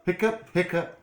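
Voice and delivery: high playful hiccups; mock hiccups. Story hiccup sound effect.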